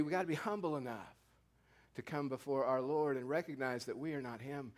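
usual speaking voice

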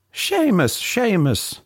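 high, singsong hail across a square; calling out. Acted. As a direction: call the name in a high, drawn-out voice. Priest hails them once more.

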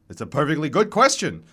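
lightly voiced old man, loud and quick; hoarse old man. A narrator's reading. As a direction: contradict your rival briskly. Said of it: "hoarse, tattered remnants of an operatic baritone".

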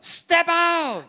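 high, loud, fiery preaching; preaching at full force. Acted. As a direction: rouse the congregation. Preaching climax.

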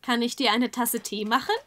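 in German; German offer of tea. Shown as a demonstration. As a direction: say it in plain German. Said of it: "How would you say that in German?".

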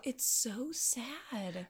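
slow, soft, whispery lament; pained sympathy. Real, not acted. Podcaster recalling the doomed vampire kid, hushed pity. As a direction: let it fade, drawn out with pity. Moved by doomed kids' scene.

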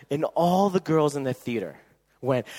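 usual speaking voice

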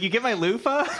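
high, laughing mock question; laughing quote. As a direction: giggle through the question. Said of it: "It's like".

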